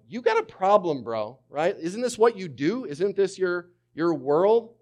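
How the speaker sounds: ordinary talk, nothing marked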